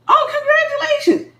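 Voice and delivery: very high, bright sing-song congrats; silly and cool. Shown as a demonstration. Speaker congratulating her ex, high playful sing-song. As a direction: toss it off lightly and playfully. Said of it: "I'm silly and cool to the point where I be like".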